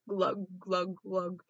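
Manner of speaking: gurgle like seasick fish; gurgling fish. Acted. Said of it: "are all like".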